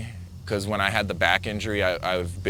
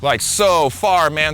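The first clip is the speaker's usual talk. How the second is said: higher, louder, slow stretched out; higher, louder awed emphasis; emphatic; 5 semitones higher, 6 dB louder, slower than usual. Real, not acted. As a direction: stretch it to show how far. Wave washing far up beach.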